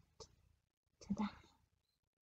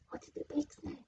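plain reading voice

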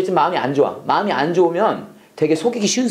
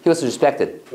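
He was respected를 한숨에 빨리; 단어를 붙여 빠르게 읽기; 3 semitones lower, much slower than usual. Shown as a demonstration. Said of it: "더 빨리 해볼까". (He was respected, 한 덩어리로 빠르게)

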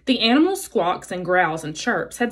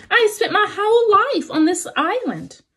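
high-pitched robot voice; storybook robot, pitched well up; 11 semitones higher than usual. A narrator's reading. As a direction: explain as a robot in a raised, measured voice. Robot character answers the animals.

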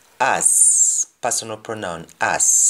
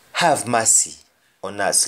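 ordinary talk, nothing marked

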